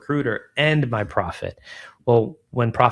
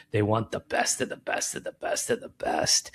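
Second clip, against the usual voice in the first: whispered aside; 6 dB quieter than usual. Real, not acted. Founder to podcast host about picky clients, in a whisper. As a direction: breathe it as a confiding whisper, barely voiced.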